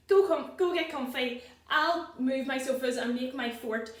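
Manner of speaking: ordinary delivery, nothing marked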